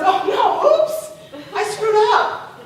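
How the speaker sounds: high, loud comic dismay; mock embarrassment. Mary Magdalene mistaking Jesus for gardener, mock embarrassed. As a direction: blurt the blunder out, hammed-up. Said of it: "How embarrassing to realize".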